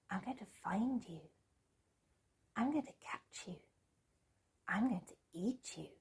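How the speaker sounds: high whispered swarm of tiny voices; tinny whining voices. Acted. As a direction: whisper it like a thousand tiny midges. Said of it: "very tinny, high-pitched, whining voices".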